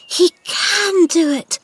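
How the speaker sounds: high, slow rising excitement; excited. A narrator's reading. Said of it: "she whispered to herself".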